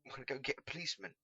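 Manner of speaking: quick, loud rushed orders; hurried exit. Acted. Pickle runs out telling Cedric to watch the madman, rushed. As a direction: call back while running out.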